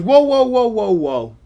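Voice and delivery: high, loud burst of protest that eases off. Retold or imitated. Gambler stops the dealers after dice are pushed out, loud protest. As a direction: imitate an alarmed gambler halting the game. Acted protest at the craps table.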